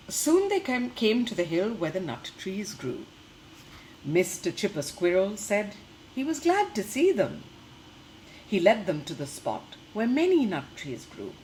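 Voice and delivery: lively storybook reading for little ones; reading to children. Acted. Teacher reading a picture book to the class, lively storytelling. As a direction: read the tale brightly to the class.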